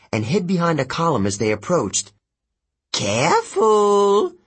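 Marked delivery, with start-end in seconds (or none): 2.9-4.3 high, drawn-out demon warning. Acted. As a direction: stretch it into an eerie, high caution. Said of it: "the other demon warned".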